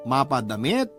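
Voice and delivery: ordinary delivery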